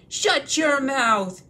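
loud, slow snap after choking; choking troll snapping. Acted. William choking on his drink, snapping back loudly. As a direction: bark it out still choking. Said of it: "he said as soon as he could".

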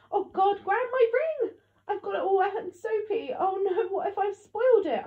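very high, loud, tearful alarm; gasped panic. A narrator's reading. Child fearing emerald ring is spoiled, panicked. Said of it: "I gasped".

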